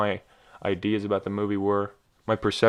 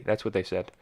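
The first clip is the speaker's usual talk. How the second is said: ordinary talk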